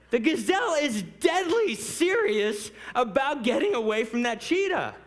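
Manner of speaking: high, loud, full-force declaration; emphatic preaching. Real, not acted. Preacher stressing the gazelle's urgency to the congregation, forceful. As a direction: punch it out at the top of your range.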